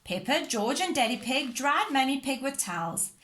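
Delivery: ordinary delivery, nothing marked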